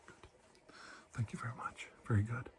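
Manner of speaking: soft whispered praise. Acted. Doctor thanking the patient for holding still, whisper. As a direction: murmur thanks gently.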